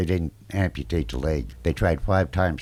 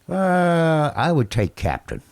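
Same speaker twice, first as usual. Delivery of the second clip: facetious; 11 semitones higher, 3 dB louder, much slower than usual. Retold or imitated. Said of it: "I'm being very facetious".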